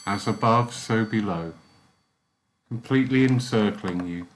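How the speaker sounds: calm closing of a guided meditation. Acted. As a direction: ease them softly back to waking.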